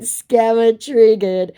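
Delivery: slow, high, loud taunt; mocking taunt. Taunting the scammer for losing it, mocking. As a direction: draw it out to needle him. Teasing reply to angry scammer.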